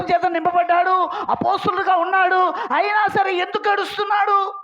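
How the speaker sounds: high, driving sermon delivery; full-force preaching. Real, not acted. Preacher asking congregation why John weeps, high-pitched urgent preaching. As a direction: pile up the points with rising urgency.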